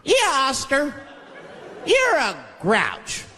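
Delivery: put-on high, sing-song kids' taunt; kids taunting. Kids on the show mocking Oscar, high taunt. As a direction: tease him like a chorus of kids. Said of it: "They judge him right in his face".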